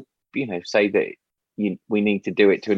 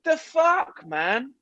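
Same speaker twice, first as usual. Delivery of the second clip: incredulous; 13 semitones higher, much slower than usual. Retold or imitated. Disbelief at smoker blocking shop door.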